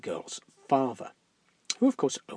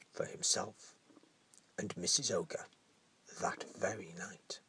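unvoiced spooky whisper; 6 semitones lower, 11 dB quieter than usual. A narrator's reading. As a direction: whisper the grim ending.

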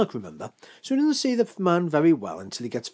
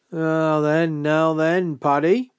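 loud, higher, drawn-out greeting; hearty host; 4 semitones higher, 7 dB louder, slower than usual. Acted. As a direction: boom it like a jovial big host. Host addresses his guest.

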